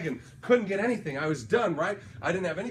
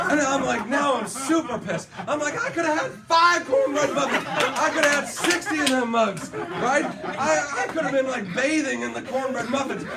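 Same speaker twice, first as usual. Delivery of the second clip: high, loud laughing rant; cracking up at his own bad luck; laughing outrage over the forgotten bill; 7 semitones higher, 7 dB louder than usual. Real, not acted. Laughing exasperation about the ten dollars.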